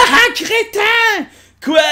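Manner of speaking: high, slow, loud mocking jeer; mocking cartoon voice. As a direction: jeer at him in a squeaky cartoon voice. Personnage se moque. (moquerie aiguë et lente)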